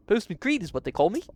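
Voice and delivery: quick, high, loud mock-Liverpool postman. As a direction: rattle it off in a badly faked Liverpool accent. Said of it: "he's gonna sound like [name]".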